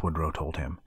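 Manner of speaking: usual speaking voice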